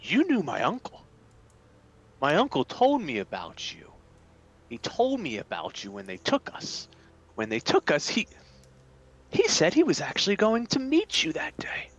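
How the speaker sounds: halting, dawning recognition, thinning to breath; confused captive character. Acted. Captive telling uncle's friend of the capture, halting and hushed at the end. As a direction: piece the memory together, trailing into near-whisper.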